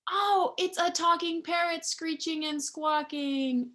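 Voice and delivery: put-on telling children it's a talking parrot, excitedly; excited reveal. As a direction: announce it like a happy surprise.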